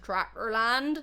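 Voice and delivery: slow, drawled West Country tractor land; mock Somerset accent. Joking about rural Scotland, in mock Somerset accent. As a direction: put on a hammy Somerset farmer accent. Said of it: "I feel sorry for all the people of Somerset".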